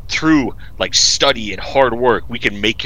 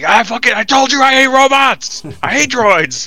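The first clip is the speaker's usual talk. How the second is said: much higher, louder hammed-up rant; mock grumpy Mando; 11 semitones higher, 5 dB louder than usual. Imagining Mando ranting about hating robots, hammed-up and loud. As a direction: spit it out like a fed-up bounty hunter. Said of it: "be like".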